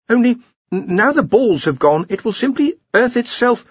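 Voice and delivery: high, hesitant explanation; nervous Ponder. A narrator's reading. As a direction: explain haltingly to the boss, pitched high. Ponder stammers a warning.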